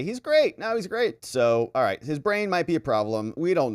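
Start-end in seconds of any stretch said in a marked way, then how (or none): 0.0-1.2 mock nervous governors. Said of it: "Two other governors say".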